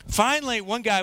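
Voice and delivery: ordinary delivery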